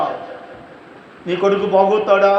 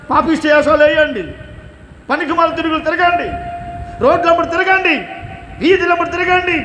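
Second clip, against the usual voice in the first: fiery preaching; 6 semitones higher than usual. Acted. Preacher rebuking careless parents, fiery shouting sermon. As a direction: berate the parents from the pulpit, climbing.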